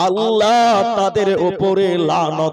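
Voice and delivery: slow solemn warning. Acted. Preacher warning the crowd of God's curse, slow and heavy. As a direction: intone the curse slowly and heavily.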